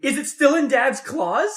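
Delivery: high-pitched, incredulous mock interrogation; mock frantic questioning.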